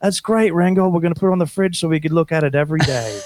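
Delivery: high cartoon voice humoring a friend; patronizing praise in a high voice; high-pitched parody of the band; cartoon bandmates. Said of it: "rest of the band's all".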